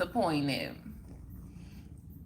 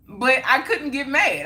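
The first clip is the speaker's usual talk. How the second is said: normal talking voice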